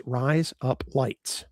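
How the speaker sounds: RISE, UP, LIGHTS said slowly, word by word; slow, separated words. Shown as a demonstration. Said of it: "you say the words".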